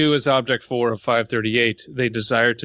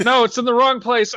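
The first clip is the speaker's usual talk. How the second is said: laughing; 12 semitones higher, 4 dB louder than usual. Real, not acted. Laughing at butt chest joke.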